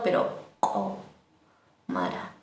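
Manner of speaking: komara slow, with a burst on the k; explosive komara. Shown as a demonstration. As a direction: pop the k in komara, draw it out. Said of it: "Así como explotando".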